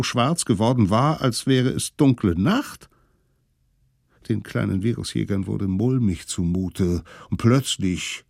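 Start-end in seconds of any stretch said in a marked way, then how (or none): none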